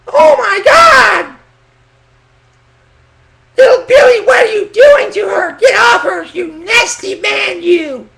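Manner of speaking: very high, slow, screaming falsetto mom catching her son; shrieking mom. Retold or imitated. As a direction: scream it like a horrified mother bursting in. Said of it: "you imagine your mom walked in on y'all".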